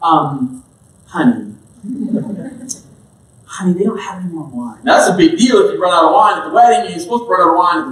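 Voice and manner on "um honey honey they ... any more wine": soft, low, coaxing mother's hint; pleading mom. Retold or imitated. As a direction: gently nudge your son, honey-sweet. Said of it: "Jesus' mom looking at Jesus and being like".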